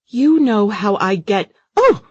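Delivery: high, loud startled shriek; sudden frightened shriek at the wheel. Acted. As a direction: cry out as a noise erupts. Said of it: "she shrieked".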